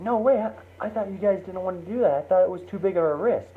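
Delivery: high, quick disbelief; teen caught off guard; surprised. Retold or imitated. Daughter surprised at parents' decision.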